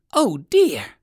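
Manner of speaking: high, loud exclamation; exclaiming. A narrator's reading. Unseen voice calling from the bushes, exclaiming. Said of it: "exclaimed the voice again".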